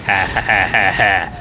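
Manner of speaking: loud mock villain laugh; ha-ha like a cartoon baddie. Villain laugh before the fight.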